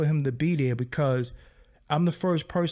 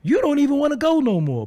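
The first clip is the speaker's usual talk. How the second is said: loud, high, fed-up scolding; exasperated yelling at the kid; 9 semitones higher, 6 dB louder than usual. Retold or imitated. Uncle scolding Little Buddy for skipping interviews, loud exasperated yell. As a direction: yell at him like he's crazy for turning it down. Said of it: "I'm like".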